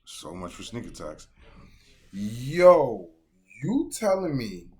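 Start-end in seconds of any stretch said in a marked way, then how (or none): 2.1-4.7 loud, high, stretched out disbelief; excited disbelief. Real, not acted. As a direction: yell it like you can't believe it. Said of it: "Nah, that's wild".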